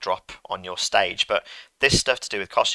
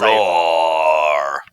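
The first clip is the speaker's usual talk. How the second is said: low, loud, drawn-out roar; loud bear roar; 5 semitones lower, 7 dB louder than usual. Acted. Sound effect played back for the class, loud low roar. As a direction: give a big hungry-bear roar.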